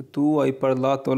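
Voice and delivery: very slow, each Italian word laid out clearly; slow model reading. Shown as a demonstration. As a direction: read it slowly for learners to copy. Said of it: "teacher models Italian phrase". (धीरे-धीरे साफ़ उच्चारण)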